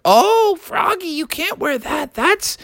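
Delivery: high falsetto mom, slowing with concern; put-on falsetto mom, drawn out. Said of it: "his mom was like".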